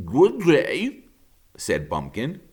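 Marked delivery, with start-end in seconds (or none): 0.1-1.0 polite raccoon greeting. Acted.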